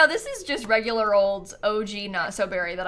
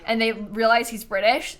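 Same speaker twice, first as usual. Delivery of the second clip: normal talking voice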